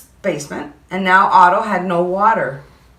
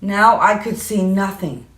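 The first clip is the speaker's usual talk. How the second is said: ordinary delivery, nothing marked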